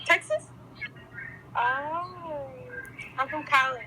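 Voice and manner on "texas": high, louder surprised rise; surprised question. Real, not acted. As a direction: ask it back in surprise. Surprised repeat.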